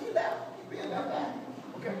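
usual speaking voice

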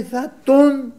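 high, loud mock threat; imitated flare of temper, cut short; retold angry brother. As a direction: burst out as if about to grab someone. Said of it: "Και νευρίασε ο αδερφός".

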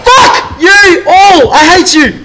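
very high, loud drawn-out screamed curse; screaming in fright. Real, not acted. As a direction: scream abuse after a jump scare. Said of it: "What are you guys screaming?".

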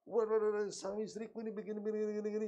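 high, loud crowing over a beaten wife; gloating husband. Retold or imitated. Husband crowing at his silenced wife, mock triumphant. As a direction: crow like a man who just won the argument.

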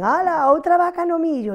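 put-on children parody their teacher's scolding tone, sing-song; mock teacher's tone. Children imitating the teacher, in a mocking high tone. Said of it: "así con ese tonito".

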